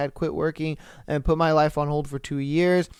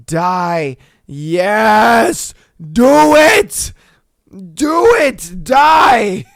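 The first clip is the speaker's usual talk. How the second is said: higher and louder, building to a shout; gleeful wife; 5 semitones higher, 10 dB louder, much slower than usual. Retold or imitated. Poisoner wife cheering on her dying husband, rising gleeful shout. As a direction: egg him on to die, gloating. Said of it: "she's just like".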